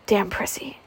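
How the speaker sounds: a sharp whispered curse. A narrator's reading. Scarlett alone in the hall, cursing the runaway maid in a whisper. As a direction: hiss it under your breath.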